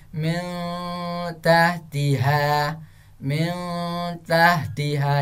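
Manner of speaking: slow "mil tahtiha" with n blurred into a hum; chanted, hummed "mil" before "tahtiha"; veiled ikhfa recitation. Shown as a demonstration. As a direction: hide the final sound of "mil" in a held nasal. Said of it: "seperti itu cara bacanya".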